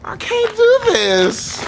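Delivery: high, rising panicked whine. Retold or imitated. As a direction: wail it in mock panic. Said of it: "I said".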